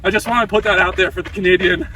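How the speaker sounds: usual speaking voice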